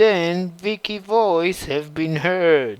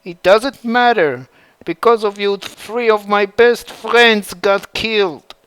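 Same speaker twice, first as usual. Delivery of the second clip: higher and louder, bitter blame; angry accusation; 4 semitones higher, 6 dB louder than usual. A narrator's reading. Tifa accusing Ivy over her dead friends, angry and raised.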